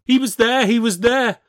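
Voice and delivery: high, loud, panicked insistence; frantic high exclamation; frightened cry. A narrator's reading. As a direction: cry it out, desperate to be believed. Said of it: "I cried".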